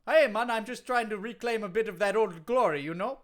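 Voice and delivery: lilting put-on Jamaican wrestler; hammed-up Jamaican accent; Kofi Kingston, Jamaican accent. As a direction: protest in a mock Jamaican accent. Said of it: "you from Jamaica again now?".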